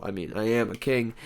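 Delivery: ordinary talk, nothing marked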